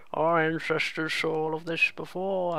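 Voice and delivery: slow, lightly voiced old elder; old person voice. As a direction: speak as a wise old elder, slowly. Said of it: "I'm just doing a generic old person voice".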